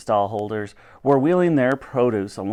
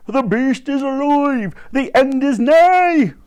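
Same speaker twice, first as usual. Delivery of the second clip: high, slow, building proclamation; doom-monger's shout; 11 semitones higher, 4 dB louder, slower than usual. A narrator's reading. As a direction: shout it like a street prophet of doom. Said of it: "he shouted".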